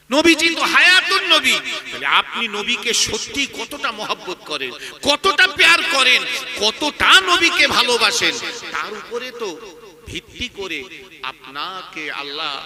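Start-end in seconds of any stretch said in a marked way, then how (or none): 0.1-8.3 loud, high preaching burst; impassioned challenge. Real, not acted. Preacher asking listeners how much they love the Prophet, impassioned loud challenge. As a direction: challenge the crowd with raised, pressing voice.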